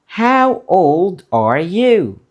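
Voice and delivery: slow and drawn out, each word clear; slow model question. Shown as a demonstration. Teacher repeating the question slowly for students to copy. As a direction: stretch it out slowly for the learners.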